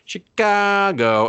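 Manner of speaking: "Chicago" stretched slowly, pitched high; drawn-out Chicago. Retold or imitated. Imitating the friend bragging about Chicago, drawn out. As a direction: draw out "Chicago", high and mocking. Mocks how friend says the city.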